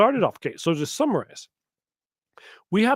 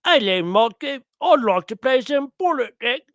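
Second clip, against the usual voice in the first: mock politician; 8 semitones higher, 4 dB louder than usual. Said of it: "someone was like".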